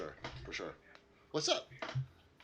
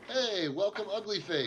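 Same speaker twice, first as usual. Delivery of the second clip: excited greeting; 7 semitones higher than usual. Real, not acted. Greeting chat viewer.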